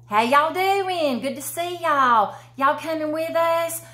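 demoing y'all to a visitor, cheery; friendly Southern greeting. Shown as a demonstration. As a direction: greet them like a neighbor. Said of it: "is y'all".

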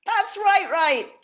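high, loud giggling twin; second twin giggling agreement. Acted. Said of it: "giggled the older li".

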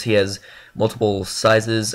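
ordinary delivery

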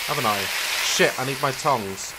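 ordinary delivery

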